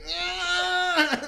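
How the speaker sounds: loud high pained groan; moaning groan. Retold or imitated. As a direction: moan like a man in agony. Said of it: "moaning and groaning".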